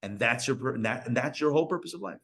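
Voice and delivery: ordinary talk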